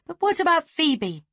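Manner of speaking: high, loud outburst; a sharp, loud cry of protest; cried out. Acted. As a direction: cry it out, pleading to be believed. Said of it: "she cried".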